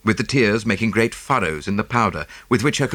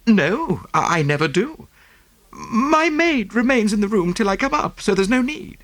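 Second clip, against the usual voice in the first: high, breathless socialite voice; gushing rich American lady; 12 semitones higher than usual. Acted. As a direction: pour it out like a fussy rich lady. Mrs Oppleson voiced.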